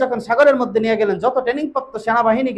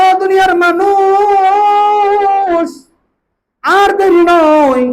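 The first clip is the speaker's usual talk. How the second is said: loud, high, long-drawn chanted proclamation; calling out; 9 semitones higher, 7 dB louder, much slower than usual. Retold or imitated. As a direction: cry out to the whole world, stretching the last word. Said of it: "ডাক দিয়ে বললেন".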